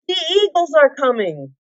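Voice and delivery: high, thrilled announcement; excited cry. A narrator's reading. Bilbo telling the elves the eagles come, excited cry. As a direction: call out the rescue with joy. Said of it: "he shouted".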